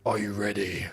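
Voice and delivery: low, quiet growled wrestler challenge; Triple H. Acted. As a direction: start the wrestler's catchphrase low and menacing. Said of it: "Shall I say that as Triple H?".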